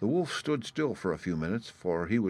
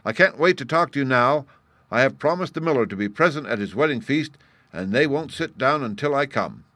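higher, louder hurried announcement; calling out; 5 semitones higher, 7 dB louder than usual. A narrator's reading. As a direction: call back while galloping past. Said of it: "he called to the boy as he galloped past".

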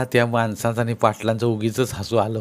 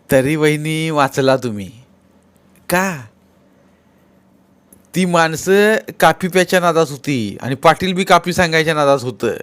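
villager telling a tale, pitched higher; Mahadu; 5 semitones higher, 5 dB louder than usual. Acted. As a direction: recount it to sister-in-law in a raised voice. (चढ्या आवाजात किस्सा सांगणारा गडी)

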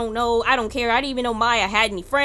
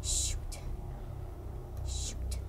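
ordinary talk

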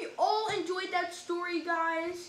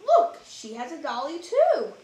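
lively, sing-song storybook reading; reading to children; 2 semitones lower than usual. A narrator's reading. Reading a rhyming picture book to kids, animated. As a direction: read it like bedtime for little ones.

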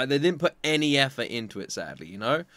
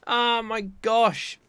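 moved sigh; 6 semitones higher, 4 dB louder, much slower than usual. Real, not acted. Moved by anime.

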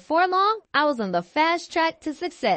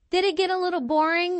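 usual speaking voice

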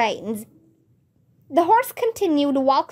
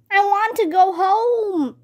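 wailing; 7 semitones higher than usual. A narrator's reading. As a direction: wail it plaintively without much volume. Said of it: "Josh wailed".

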